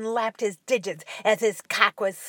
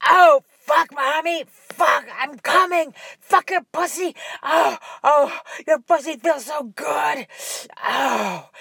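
shouting; 6 semitones higher, 8 dB louder than usual. Acted. Son climaxing, shouting.

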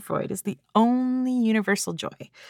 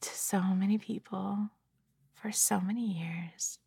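soft unvoiced whisper; 9 dB quieter than usual. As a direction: breathe the words gently. Whispered soothing intro.

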